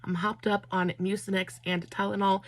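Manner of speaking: normal talking voice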